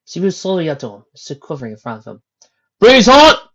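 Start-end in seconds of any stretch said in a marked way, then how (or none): 2.8-3.4 loud, high command to halt; captain calling a pony out; stern captain's call. A narrator's reading. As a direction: bark the order again at the crowd. Captain calls out.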